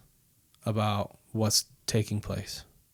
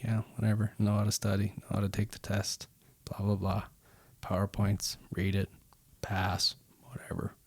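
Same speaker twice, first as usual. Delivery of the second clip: bored dismissive; 6 dB quieter than usual. Retold or imitated. Bored student mimicking college routine.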